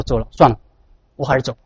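soft, low, resigned giving up; acted disappointment. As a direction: let the voice sink as if giving up. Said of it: "非常失望".

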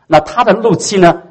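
ordinary delivery

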